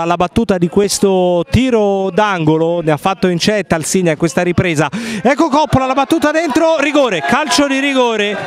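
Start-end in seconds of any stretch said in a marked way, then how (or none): 5.2-8.3 rising burst of live commentary; excited penalty call. Real, not acted. Penalty awarded, commentator erupts. (annuncio concitato del rigore)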